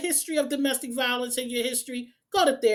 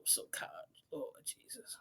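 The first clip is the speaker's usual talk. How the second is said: whispered prayer; 8 semitones lower, 22 dB quieter than usual. Real, not acted. Exasperated aside after emotional rant.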